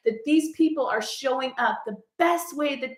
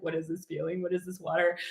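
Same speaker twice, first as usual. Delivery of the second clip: puzzled, much lower and softer, as if tears are alien; mock bewildered at tears; 6 semitones lower, 8 dB quieter, faster than usual. Laughing at herself for crying, hushed mock bewilderment. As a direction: voice yourself baffled by crying. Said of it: "I, like, never cry".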